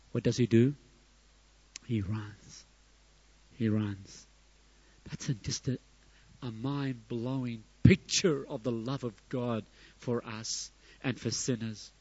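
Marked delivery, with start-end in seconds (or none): none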